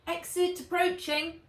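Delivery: high called-out warning; dragon calling out. A narrator's reading. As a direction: call out the exit. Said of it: "shouted Fizz".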